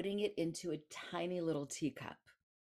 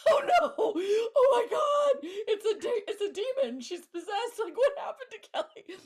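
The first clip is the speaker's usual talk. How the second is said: much higher and louder, laughing through hammed-up horror; mock panic; 11 dB louder than usual. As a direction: shriek it like terrified onlookers seeing a demon. Paramedics panicking over her body.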